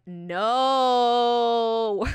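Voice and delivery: long, loud, high "no"; hammed-up refusal. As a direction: stretch the word out in mock defiance. Acts out Jessica's refusal.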